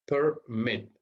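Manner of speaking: "Permit" in two separate beats, per... MIT; syllables split. Shown as a demonstration. Teacher showing it has two syllables, "Permit" split as per... MIT. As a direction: leave a gap between "Per" and "mit". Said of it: "Tiene dos sílabas".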